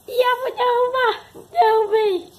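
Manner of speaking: high, loud, slow playful cry; playful high shout. Real, not acted. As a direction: shout it out in play. Playful shout while playing. (grito brincalhão e agudo)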